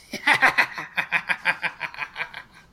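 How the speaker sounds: loud, long witchy cackle; crone's cackle. Acted. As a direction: cackle away at your own card joke. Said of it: "cackles in laughter".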